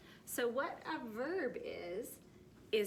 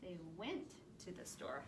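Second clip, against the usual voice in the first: much softer, lower reading; 4 semitones lower, 10 dB quieter than usual. Shown as a demonstration. Teacher quietly rereading the past tense sentence. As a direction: read it softly and low. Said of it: "happened in the past".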